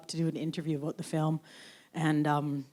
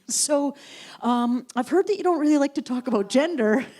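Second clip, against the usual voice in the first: higher, louder prim reporter voice; mock reporter; 8 semitones higher, 5 dB louder than usual. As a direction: parody a clueless reporter. Imitating a reporter.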